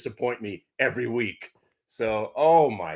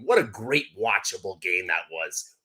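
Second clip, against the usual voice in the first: normal talking voice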